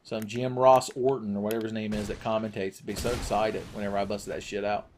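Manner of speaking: usual speaking voice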